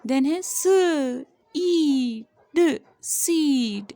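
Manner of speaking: very slow, letter by letter स, ई, ड, then सीड; slow sounding out of सीड; slow phonics blending. Shown as a demonstration. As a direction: stretch each sound out for the child. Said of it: "phonics blending demo".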